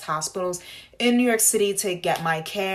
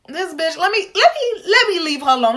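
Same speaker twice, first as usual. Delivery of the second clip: high, louder mock nurse muttering; a hint of an annoyed nurse; 11 semitones higher, 6 dB louder than usual. The nurse thinking to himself, a lightly voiced high grumble. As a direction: lightly voice the offended nurse backing off.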